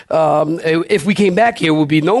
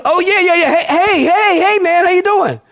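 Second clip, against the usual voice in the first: excited airport greeting; 11 semitones higher than usual. Retold or imitated. Pastor retelling meeting his host at the airport, excited greeting. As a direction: greet a stranger you finally spotted with delight.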